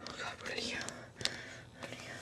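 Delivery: hushed scared whisper; whisper, hiding. Acted.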